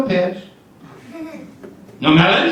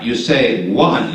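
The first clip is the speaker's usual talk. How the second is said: usual speaking voice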